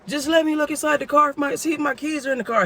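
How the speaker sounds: high, whiny plea; pleading thief. Retold or imitated. Thief begging the narrator to check his keys. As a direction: beg to look in the car.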